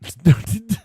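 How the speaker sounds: loud, high, laughing query; laughing incredulously. Real, not acted. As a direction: burst out laughing on the word. Cracks up at listener's message.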